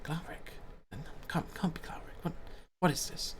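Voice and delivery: hushed, disbelieving crowd whispers. Acted. Townspeople whispering to each other about Glaric, whispered in disbelief. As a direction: whisper it in broken fragments. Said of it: "some whispers going through the crowd".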